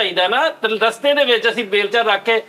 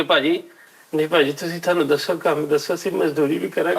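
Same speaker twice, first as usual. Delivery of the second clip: a lightly voiced, lower, softer plea of a poor laborer; mock-humble whimpering plea; pitiful pleading; 4 semitones lower, 3 dB quieter than usual. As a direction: beg meekly for work to win sympathy. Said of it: "ਅਸੀਂ ਕਹੀਦਾ ਉਹਨਾਂ ਨੂੰ".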